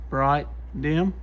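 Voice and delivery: ordinary delivery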